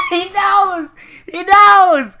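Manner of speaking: high, loud gleeful yell; whooping with delight; excited shout. Real, not acted. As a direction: crow it at the top of your voice. Gleeful reaction to the clip.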